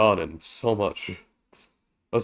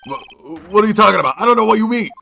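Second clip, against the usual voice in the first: much higher, louder panicked squeal; panicked denial; 11 semitones higher, 7 dB louder, faster than usual. Acted. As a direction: deny everything in a high panic. Witness denies in a panic.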